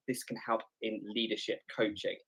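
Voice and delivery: ordinary talk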